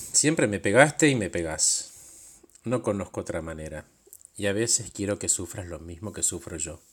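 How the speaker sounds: ordinary delivery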